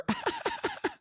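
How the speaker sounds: very high squeaky laugh; squealing laugh. Real, not acted. Laugh after own joke.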